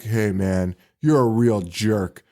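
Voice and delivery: Dan Haggerty impression. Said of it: "A plus Dan Haggerty impression".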